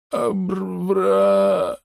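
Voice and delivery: high gurgling babble; gurgling alien voice. Acted. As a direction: gurgle it out, stunned. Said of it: "пробулькал док".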